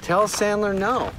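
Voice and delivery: high, slow insistent order; hammed-up petulant demand; mock demand. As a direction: spell it out like an ultimatum. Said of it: "He goes".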